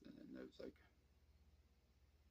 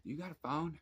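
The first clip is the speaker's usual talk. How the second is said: put-on higher, louder young woman's ask; lightly voiced girl; 11 semitones higher, 9 dB louder than usual. Girl knocking on his tent at dawn, lightly voiced higher ask.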